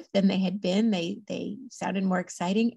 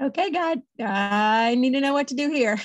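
mock desperate plea; 5 semitones higher, 4 dB louder than usual. New mother asking God for help, playful retold desperation. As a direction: plead with God like an overwhelmed parent.

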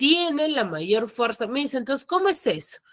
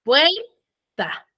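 "puerta" with first syllable raised, then dropping on "ta"; high PUER, falling ta; 6 semitones higher than usual. Shown as a demonstration. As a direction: say "puerta" in two beats, up then down. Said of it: "mi voz va bajando".

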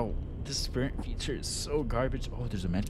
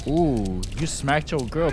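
ordinary delivery

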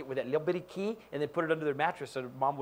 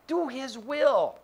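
very slow, high "Do his will"; preacher's drawn-out point; slow emphatic; 7 semitones higher, 4 dB louder, much slower than usual. Real, not acted. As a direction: hammer each word out.

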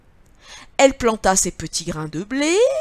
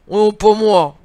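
grunting pig; 9 dB louder, slower than usual. Acted. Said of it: "grogna le cochon".

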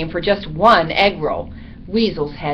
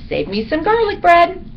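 high, bright-pitched bat call; squeaky cheerful goodbye request; parting call; 11 semitones higher, 4 dB louder than usual. A narrator's reading. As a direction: call back up high while leaving. Bat calls out while flying off.

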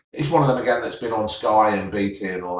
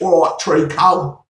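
louder, slow mock Cockney greeting; mocking Danny Dyer's Cockney patter, louder and slow; 4 semitones higher, 6 dB louder than usual. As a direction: ham up a Danny Dyer Cockney hello. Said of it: "It's all".

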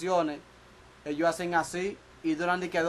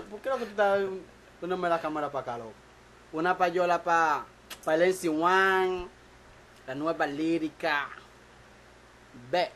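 ordinary talk, nothing marked

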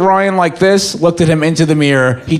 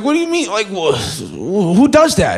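mock crying outrage; 3 semitones higher than usual. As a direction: protest through tears. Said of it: "I was like".

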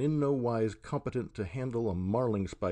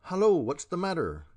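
loud and higher, a woken sailor calling out; gruff startled hail; growling call; 8 semitones higher, 5 dB louder than usual. A narrator's reading. Woken forecastleman calls out to the commotion, growling. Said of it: "came growling from a forecastleman".

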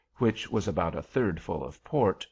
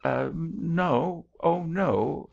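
higher, hesitant backpedalling; alarmed; 9 semitones higher, much slower than usual. A narrator's reading. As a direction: stammer a hasty, alarmed denial. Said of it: "much alarmed".

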